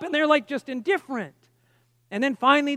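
ordinary delivery

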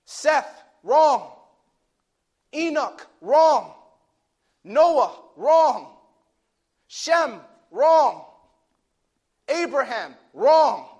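loud, high preacher's roll call; full-force preaching. Acted. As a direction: hammer each verdict like a revival preacher.

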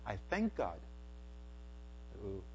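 usual speaking voice

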